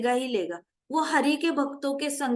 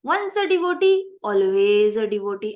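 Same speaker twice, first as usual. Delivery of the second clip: loud, ringing declaration, the second half drawn out slowly; emphatic slogan; 4 semitones lower, 6 dB louder, much slower than usual. Real, not acted. Teacher to her class, proclaiming the devotee motto loudly.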